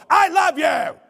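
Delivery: very loud, high shout at the dog; mock angry yell. Preacher shows tone beats words to a dog, shouting harshly. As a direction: yell loving words as if scolding. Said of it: "your body language and your tone".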